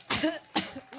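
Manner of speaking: rough hacking cough; chesty cough. Real, not acted. Coughing through a speech.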